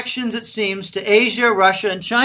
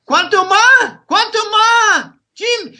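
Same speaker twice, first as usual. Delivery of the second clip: imitating a Nicaraguan Spanish accent, pitched higher and dropping the final S; a light mock of Nicaraguan locals asking "Cuanto más?"; 12 semitones higher, 4 dB louder, slower than usual. Teller mimics Nicaraguan locals asking a price, in a light Nicaraguan Spanish accent. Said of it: "the Nicaraguan accent".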